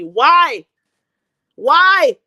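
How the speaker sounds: loud, high exasperated why; exasperated yell. Real, not acted. Ranting at viewers watching her, loud exasperated yell. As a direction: shout it out in disbelief.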